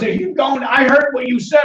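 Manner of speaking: retold teacher, high and insistent; eager teacher imitation. Retells the teacher accosting him.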